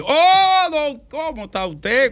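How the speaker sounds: the mystery caller greets the host in a booming voice; deep guttural caller. Retold or imitated. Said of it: "con esa voz así, gutural".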